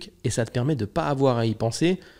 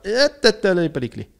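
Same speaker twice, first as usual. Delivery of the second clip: high, louder self-interruption; alerte intérieure; 8 semitones higher, 5 dB louder than usual. Acted. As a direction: blurt it out as a sudden inner alarm. Acte son alerte intérieure avant de sortir. (alerte soudaine, voix plus aiguë)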